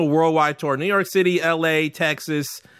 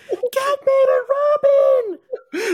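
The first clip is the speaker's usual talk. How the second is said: very high, stretched-out yell from the crowd; slow mock fan scream, pitched way up; crowd member yelling; 4 dB louder, much slower than usual. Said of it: "Shout it out in the crowd".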